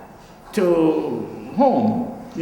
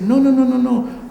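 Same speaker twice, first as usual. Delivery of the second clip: louder, higher run of no; teachers saying no; 7 semitones higher, 5 dB louder than usual. Retold or imitated. Teachers correcting the student's cut-off figures, a firm, louder refusal. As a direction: shut the idea down with a firm string of no. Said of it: "they said".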